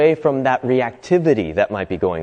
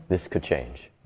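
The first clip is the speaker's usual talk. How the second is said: much softer and lower, slower repeat; quiet inner voice; 11 semitones lower, 12 dB quieter, slower than usual. Retold or imitated. The hopeful part inside despair repeating itself, soft and low. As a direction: let the hope settle, low and soft. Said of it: "still going like".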